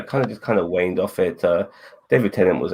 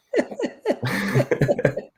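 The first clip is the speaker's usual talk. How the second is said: high, airy laughter; real high-pitched giggling. Real, not acted. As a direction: laugh at your own joke.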